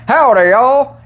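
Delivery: high, loud cartoony country accent; a hammed-up Southern drawl greeting; imitating Arnold putting on a country accent; Arnold's country accent. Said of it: "Arnold Schwarzenegger doing, like, a country accent".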